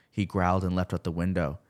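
usual speaking voice

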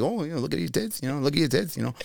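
high, soft imitating Leno; lightly voiced Leno joke; mock Leno voice. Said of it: "I was like".

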